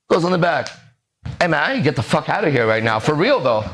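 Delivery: normal talking voice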